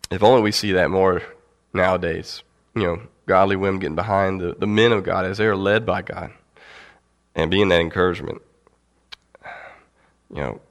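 usual speaking voice

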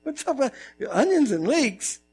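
puzzled high huh; preacher mock bewildered at the leeks; baffled. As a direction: sound confused by it. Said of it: "I'm like".

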